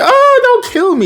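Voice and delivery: very high, laughing mock plea; hammed-up panicked begging; pleading, scared. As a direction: squeal don't kill me. Ben begging the fourth Ben.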